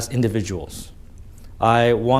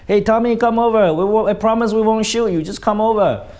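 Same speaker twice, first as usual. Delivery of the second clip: high, louder coaxing call; friendly calling over; 10 semitones higher, 3 dB louder than usual. Retold or imitated. German soldiers inviting the British across, high coaxing call. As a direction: coax the enemy over with a raised voice.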